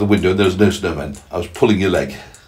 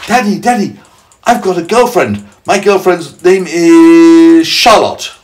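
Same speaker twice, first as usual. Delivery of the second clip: high, loud mock child, dragged out at the end; imitating an excited child; 10 semitones higher, 9 dB louder, slower than usual. Parent voicing child telling Daddy, high hammed-up excitement. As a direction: pipe up like a kid with news. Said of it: "you say to me".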